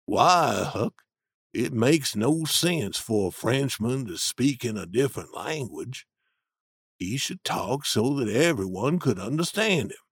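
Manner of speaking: low steady Jim arguing his point; Jim's voice. A narrator's reading. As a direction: voice the older man, grounded and sure. Jim argues.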